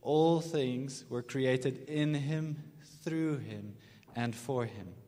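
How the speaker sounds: very slow, for the congregation to follow; slow unison recitation; slow call and response. Shown as a demonstration. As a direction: lead them through it slowly. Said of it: "So just say that with me".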